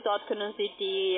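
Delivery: ordinary talk, nothing marked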